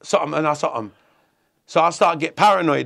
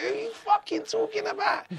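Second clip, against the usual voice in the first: very high-pitched mock question; incredulous squeak; 6 dB quieter than usual. Prisoner baffled by his mate's real name, incredulous. Retold baffled challenge to the guy.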